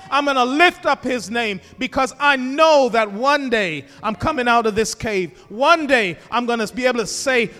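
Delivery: loud fervent preaching; preaching at full force. Acted. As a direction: drive it home to the congregation. Climax of sermon.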